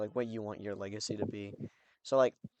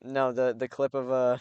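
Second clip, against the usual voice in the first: normal talking voice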